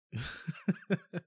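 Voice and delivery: high giggle rising up; stoned giggle. Real, not acted. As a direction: loose stoned laugh. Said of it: "I'm high as hell".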